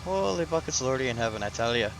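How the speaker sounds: playful fake Irish accent. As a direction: ham up an Irish brogue, then settle. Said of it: "a quick little good Irish accent".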